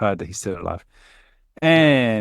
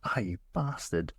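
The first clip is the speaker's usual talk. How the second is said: softer and slower, under the breath; quiet groaned curse; 3 semitones lower, 12 dB quieter than usual. Retold or imitated. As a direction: swear through the pain under your breath. Knife metal hits his eye.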